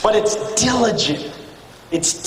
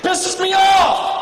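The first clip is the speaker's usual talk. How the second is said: angry outburst; 9 semitones higher, slower than usual. Real, not acted. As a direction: let the frustration burst out. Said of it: "it makes me mad".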